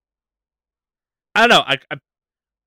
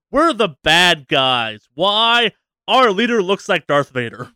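louder, higher send-up of cartoonish bad guys; mock villain announcement; 4 semitones higher, 5 dB louder, slower than usual. Game's default enemies introducing themselves, mock villain announcement. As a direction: declare it like hammed-up villains.

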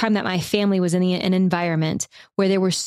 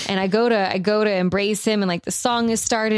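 ordinary talk

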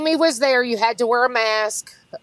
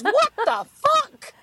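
high, stretched-out shocked cry; shocked outburst; 13 semitones higher, slower than usual. Retold or imitated. Medium imitating her stunned reaction to the host. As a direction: blurt it out in disbelief. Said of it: "she goes".

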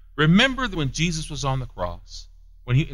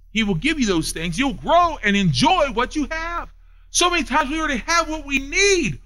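climbing higher and louder as the sermon builds; rising preaching; 8 semitones higher, 5 dB louder than usual. Acted. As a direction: preach it up, pitch lifting line by line.

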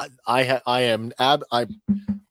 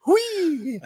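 very high, drawn-out little whee; gleeful put-on squeak, repeated; playful squeal; much slower than usual. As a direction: squeal like a tiny creature spinning in a chair. Imitating Grogu spinning in chair.

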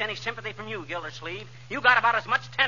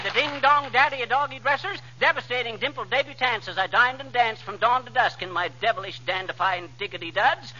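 rapid patter; 3 semitones higher than usual. Acted. Breathless alliterative tongue-twister brag.

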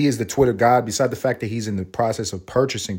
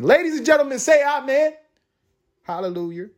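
much higher, sing-song church call; mock preacher; 14 semitones higher than usual. Host hails Elon to listeners, mock preacher call. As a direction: rouse the congregation like a preacher.

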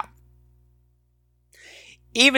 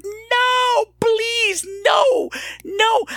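high, pleading wail of no; pleading cry; much slower than usual. Retold or imitated. Viewer crying out at the movie, high desperate pleading. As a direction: beg the screen not to let them die. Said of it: "I was like".

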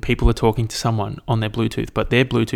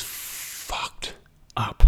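whisper; 12 dB quieter than usual. Real, not acted. Recalls awful ice bath.

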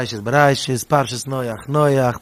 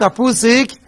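pupil asking; 5 semitones higher, 6 dB louder than usual. Retold or imitated. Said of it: "jede Jüngel hat ihm gesagt".

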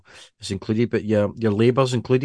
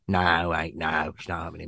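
mock London dealer, low and quieter; London accent; 5 semitones lower, 7 dB quieter than usual. London bike dealer refusing a customer, mock London accent. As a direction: brush him off in a put-on London accent. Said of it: "we're really nailing the accents".

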